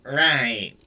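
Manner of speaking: Janine's nasal New York drawl; quick nasal New York "oh, right"; nasal New York twang. Acted. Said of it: "in her nasal New York twang".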